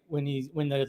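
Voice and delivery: ordinary talk, nothing marked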